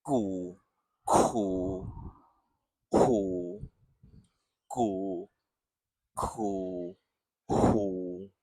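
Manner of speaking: ku repeated low at the back; drilled syllables. Shown as a demonstration. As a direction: drill ku one at a time. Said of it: "one more with a different vowel".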